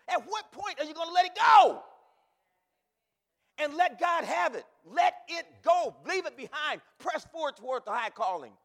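shouted, high and loud; full-force pulpit shout; preaching shout. Acted. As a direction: bellow the challenge at the congregation. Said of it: "you say".

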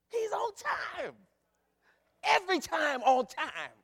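high, loud, slow shout of he's on time; preaching shout. Real, not acted. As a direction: stretch each word, preaching at full force.

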